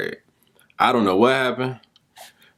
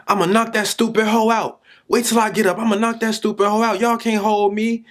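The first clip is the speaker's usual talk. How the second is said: imitating a screaming kid; 12 semitones higher than usual. Teacher retelling a furious boy's cursing, high mock yell. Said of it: "He was screaming".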